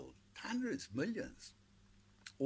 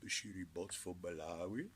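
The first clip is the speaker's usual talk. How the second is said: stuffy cold voice; 4 semitones lower, 6 dB quieter than usual. Shown as a demonstration. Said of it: "like you got a cold".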